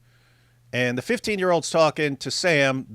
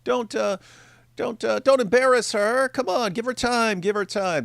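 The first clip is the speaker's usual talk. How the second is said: much higher, mocking whiny voice; mocking impression; 10 semitones higher than usual. Host mocking Sam defending Cora, high whiny. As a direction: parody a pleading apologist. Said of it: "this is the guy who".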